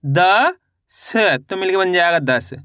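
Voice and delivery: द long and rising, स apart and falling, then दस blended; द... स... separated by pauses, then दस as one word; letter-by-letter reading. Shown as a demonstration. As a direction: sound out द and स slowly, then join them as दस. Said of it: "इस तरीके से आपको एक ही अक्षर का उच्चारण करके".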